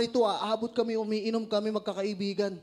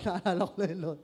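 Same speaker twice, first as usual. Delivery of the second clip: laughing; faster than usual. Real, not acted. Laughing at karate memory.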